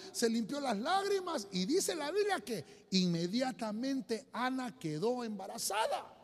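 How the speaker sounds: ordinary talk, nothing marked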